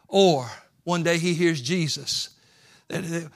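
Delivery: usual speaking voice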